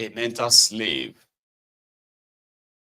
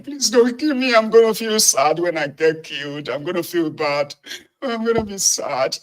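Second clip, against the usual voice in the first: high, loud whiny pleading; mock sobbing plea, much higher; mock begging cry; 7 semitones higher, 6 dB louder than usual. Shown as a demonstration. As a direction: ham up a tearful plea for your life. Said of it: "Then I will start crying".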